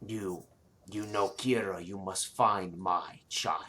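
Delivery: slow, low, soft dragon plea; grave dragon murmur. Acted. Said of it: "And this bellows out".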